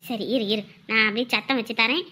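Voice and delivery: low, soft reassurance; hushed aside. Acted. Grandparent sets up speakerphone for the child, hushed. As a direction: murmur it away from the phone.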